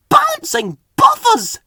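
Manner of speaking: high slow alarmed exclamation; worried. A narrator's reading. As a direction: gasp it out in alarm, drawn out. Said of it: "Percy thought worriedly".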